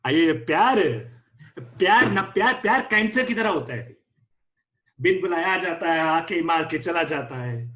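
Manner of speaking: put-on frustrated South Indian accent; exasperated rant in South Indian accent. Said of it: "Your mood is frustrated. Your accent is South Indian".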